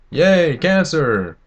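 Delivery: sarcastic cheer. Pretend. Mocking people who think smoking's cool, sarcastic slow cheer. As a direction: cheer it like you're mocking them.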